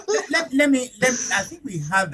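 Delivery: laughing through the words; high giggle while starting to speak. Real, not acted. Host laughs mid-sentence.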